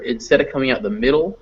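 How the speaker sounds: ordinary talk, nothing marked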